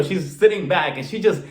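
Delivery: ordinary delivery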